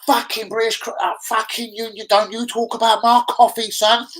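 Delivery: passionate coffee defender. Pretend. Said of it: "does anyone wanna passionately defend".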